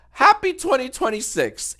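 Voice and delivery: high, loud, upbeat video opener; YouTuber voice. Acted. Streamer opening the video with an upbeat YouTuber voice. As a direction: burst in like a channel intro. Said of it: "Do you always talk like a radio host".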